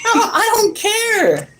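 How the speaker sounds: high, slow overstated no; hammy refusal; exaggerated refusal. Real, not acted. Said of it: "repeated refusal".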